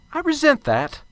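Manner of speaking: offended. Acted. Offended at being called egghead.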